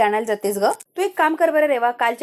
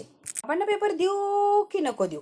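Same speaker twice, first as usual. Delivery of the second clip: higher, softer and slow, wondering aloud; hesitant musing; 8 semitones higher, 6 dB quieter, slower than usual. Acted. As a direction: torn inner question, drawn out and quiet. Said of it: "Reva आता विचार करत असते".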